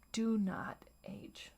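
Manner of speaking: slow, hushed command; slow whisper. Retold or imitated. Voicing the inner critic forbidding aging, slow whisper. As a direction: whisper it slowly like a spell. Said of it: "saying things ... that sound like".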